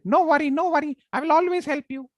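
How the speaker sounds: very high, squeaky grateful mouse; tiny squeaky mouse thanking; shrill mouse voice. Acted. Mouse thanks the lion for saving it, squeaky. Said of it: "It was shrill".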